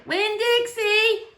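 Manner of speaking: long high drawn-out holler. A narrator's reading. Girl hollering for her dog from the trailer door. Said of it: "I hollered".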